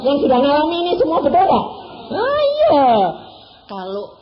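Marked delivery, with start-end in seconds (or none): 0.1-2.9 high, loud playful prodding of the crowd; teasing excited call. Real, not acted. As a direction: egg the congregation on. Preacher teasing congregation loudly. (seruan menggoda penuh semangat)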